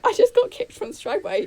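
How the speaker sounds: acted-out high, squeaky protest; high-pitched retold reply. Acting out her own startled reply.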